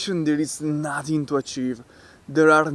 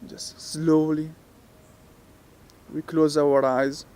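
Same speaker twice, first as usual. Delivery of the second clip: soft, unhurried meditation voice with long pauses; guided meditation; 3 semitones lower, 8 dB quieter than usual. Real, not acted. Teacher talking listeners through relaxing the muscles, guided meditation. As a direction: guide them gently, leaving space after each phrase.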